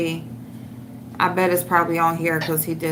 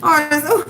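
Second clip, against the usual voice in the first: high, crying-laugh wail; 7 semitones higher, faster than usual. Real, not acted. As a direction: laugh until it sounds like sobbing. Overcome by laughter at photo.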